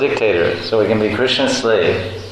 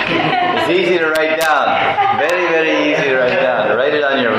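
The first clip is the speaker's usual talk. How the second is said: teasing; 6 semitones higher than usual. Real, not acted.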